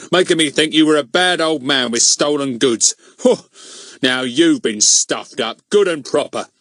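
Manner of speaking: loud, scolding Mister Goon figure; triumphant telling-off in a character voice; gloating, bullying policeman. Acted.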